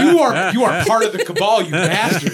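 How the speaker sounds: loud laughing accusation; laughing outburst. Real, not acted. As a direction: laugh while yelling at him.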